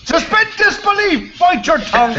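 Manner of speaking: high mock scolding; mock outraged. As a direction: cry out in fake outrage.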